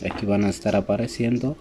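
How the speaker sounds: ordinary talk, nothing marked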